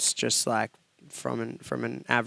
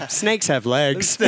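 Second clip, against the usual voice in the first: stubborn repeat; 3 semitones higher, 4 dB louder, much slower than usual. Retold or imitated. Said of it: "kept on the motion".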